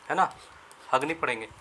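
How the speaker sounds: usual speaking voice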